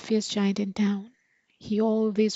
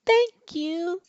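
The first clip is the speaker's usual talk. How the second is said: much higher thanks; a bright goat thank-you; grateful goat; 9 semitones higher, 3 dB louder than usual. Acted. Goat thanking the giant, high. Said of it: "said the goat".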